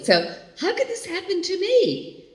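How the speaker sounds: ordinary talk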